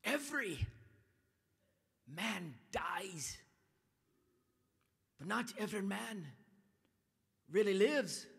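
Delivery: a lightly voiced solemn hero; a hint of a dying hero speaking his creed; hero's last words. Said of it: "He says".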